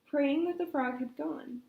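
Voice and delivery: plain reading voice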